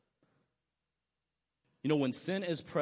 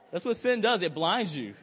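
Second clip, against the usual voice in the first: higher and louder, laughing through it; 4 semitones higher, 7 dB louder than usual. Real, not acted. Preacher to congregation, laughing through the punchline. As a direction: laugh while making the point. Laughing at his own blunder.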